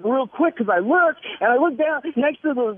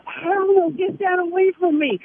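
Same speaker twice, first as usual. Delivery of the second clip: high mock refusal; 8 semitones higher than usual. Teller refusing boyfriend's cream, high mock protest. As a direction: push it away with a squeal. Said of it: "I go".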